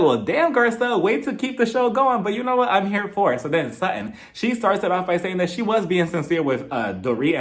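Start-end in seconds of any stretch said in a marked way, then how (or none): 0.0-3.4 high sassy. Retold or imitated. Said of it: "And I'm like".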